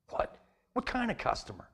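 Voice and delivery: high-pitched, incredulous question; puzzled, voice jumping up high; baffled surprise. Acted. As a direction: question it as if totally thrown. Baffled by her mention of customer.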